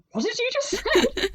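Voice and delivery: high, baffled "what did you just say"; baffled friend. Retold or imitated. Retelling a friend's baffled reply to her Dutch, high and puzzled. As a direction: play the puzzled friend hearing bad Dutch. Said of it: "you just looking at me and just going".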